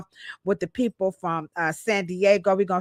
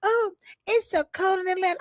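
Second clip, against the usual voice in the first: mocking Rashida; 9 semitones higher, 4 dB quieter than usual. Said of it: "you should hear Rashida and them".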